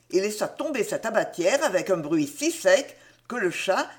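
plain reading voice

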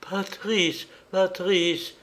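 high, frightened calling voice; frightened woman's high-pitched call; panicked cry. A narrator's reading. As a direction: call out for him, lost in the dark. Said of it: "cria Coralie que les ténèbres affolaient".

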